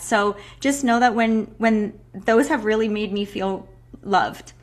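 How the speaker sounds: normal talking voice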